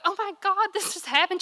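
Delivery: high-pitched retold distress call to mom; mock-upset, voice lifted high; retold near-tears upset. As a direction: re-enact a distressed phone call, pitched well up. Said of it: "I'm trying not to cry".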